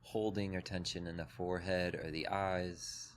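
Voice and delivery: calm, even meditation voice with long pauses; guided meditation. Acted. Teacher leading a group through breath and body, guided meditation. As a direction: guide them gently into the body, leaving space between phrases.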